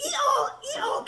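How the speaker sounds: very high, squeaky donkey imitation; play-acted high-pitched hee-haw; donkey bray. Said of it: "And the donkey went".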